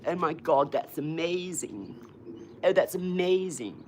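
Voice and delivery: imitating his wife's slow, drawn-out posh "amazing"; a light send-up of a posh young woman, slowed right down; mock-posh gush, stretched out. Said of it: "That's how she spoke when I met Liz".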